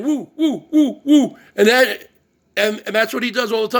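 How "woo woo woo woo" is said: woo calls. Retold or imitated. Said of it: "I started saying".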